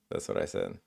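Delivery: sneaky whisper; whispered aside. Real, not acted. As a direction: whisper it under your breath. Sneaky aside after fake translation.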